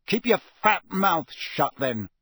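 heated, emphatic put-down; angry retort. Acted. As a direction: shut him up forcefully. Harry snaps back at Draco's insult.

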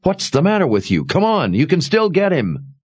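high, pressing urgency; urgent. A narrator's reading. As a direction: push her to act now. Sebastian urges her on.

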